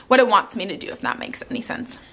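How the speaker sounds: ordinary talk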